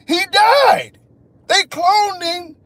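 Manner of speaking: high loud yelp; outraged yelp. Real, not acted. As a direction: yelp it in disbelief. Ranting about dead clone.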